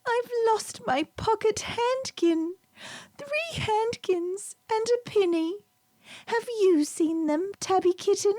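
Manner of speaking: put-on high, tearful little-girl voice; a small girl close to tears; crying little girl. Little Lucy asks the kitten, high and tearful. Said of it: "came into the farmyard crying".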